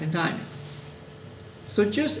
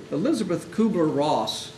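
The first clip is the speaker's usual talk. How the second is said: ordinary delivery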